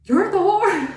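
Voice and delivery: high, loud, scornful jab at the new partner; loud mocking accusation. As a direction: sneer it out like a jealous wife.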